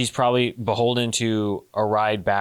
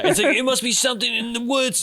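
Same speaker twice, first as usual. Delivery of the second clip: very high-pitched comic holler; over-the-top squeal of pretend discovery; mock excited shout; 12 semitones higher than usual. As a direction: play up a silly cover story. Acting out a Billy Madison cover-up.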